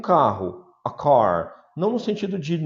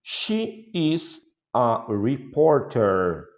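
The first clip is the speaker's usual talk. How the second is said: slow model answer; much slower than usual. Shown as a demonstration. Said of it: "slow model answer".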